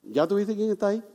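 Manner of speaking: hushed, trusting prayer to God; quiet prayer. Pretend. Preacher models a believer praying to God, soft trusting prayer. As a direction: pray it softly, like handing it over. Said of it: "Dígale".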